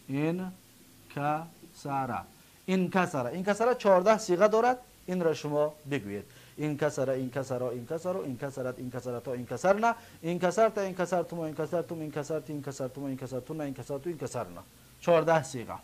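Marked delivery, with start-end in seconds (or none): 0.1-1.4 انکسر very slowly in separate syllables, in-ka-sa-ra. Shown as a demonstration. Teacher breaking down the past verb, syllable by syllable. 6.6-14.6 softer sing-through of a memorised paradigm; rote conjugation recital. Shown as a demonstration. Teacher reciting fourteen verb forms for students, rote recital.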